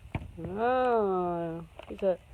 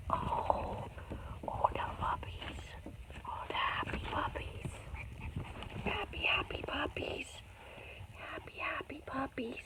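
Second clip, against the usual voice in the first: soft, whispery puppy coo; hushed pet talk; cooing whisper; 5 semitones higher, 12 dB quieter than usual. Real, not acted. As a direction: whisper adoringly over sleepy puppies. Cooing over puppies.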